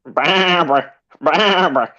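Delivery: high, drawn-out bleated name; high-pitched baa stretched through the name; mock sheep. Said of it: "That's his sheep".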